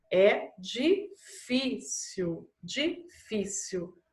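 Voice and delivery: é difícil spelled out very slowly, syllable by syllable; teacher dictating a word very slowly; slow dictation. Shown as a demonstration. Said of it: "Aqui fácil não é fácil".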